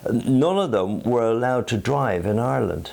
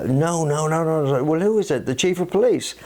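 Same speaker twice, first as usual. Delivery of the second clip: policeman's reply pitched high for the joke; higher building joke voice; 11 semitones higher than usual. Retold or imitated. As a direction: push it up and up towards the gag. Joke punchline dialogue, pitched up.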